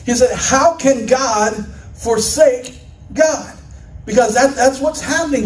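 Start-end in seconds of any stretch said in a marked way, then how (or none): 0.3-3.5 slow, weighty quoted question; Martin Luther. Retold or imitated. Preacher quoting a theologian from centuries ago, slow and deliberate. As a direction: draw the question out with pauses. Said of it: "Martin Luther, he said centuries ago".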